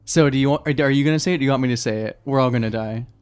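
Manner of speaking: ordinary talk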